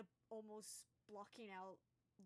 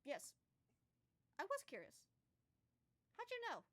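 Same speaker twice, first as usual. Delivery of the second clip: much higher, rising surprised voice; a wood elf caught out, amazed she knew; startled wood elf; 7 semitones higher, 6 dB louder than usual. Acted. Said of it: "the wood elf just kinda goes".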